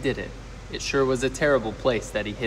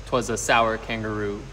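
ordinary delivery

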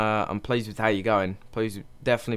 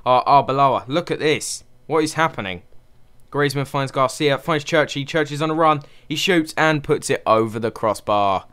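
excited play-by-play; 4 semitones higher, 5 dB louder than usual. Real, not acted. Tense counter attack on screen.

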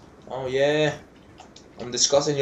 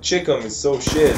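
ordinary delivery

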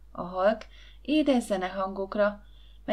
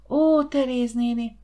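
slow, raised, blissful exclamation; drawn-out, louder happy "Ó"; happy murmuring; 4 semitones higher, 6 dB louder than usual. A narrator's reading. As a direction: savour the joy with a smile. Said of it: "rebegte boldog mosollyal Cilike".

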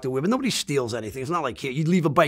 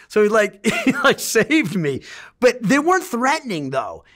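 ordinary delivery

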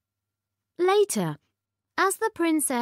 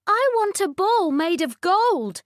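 high, loud spoiled princess; demanding little princess voice; cartoon princess; 11 semitones higher, 6 dB louder than usual. A narrator's reading. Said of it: "said the princess".

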